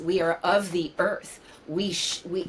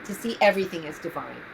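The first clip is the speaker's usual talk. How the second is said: ordinary talk, nothing marked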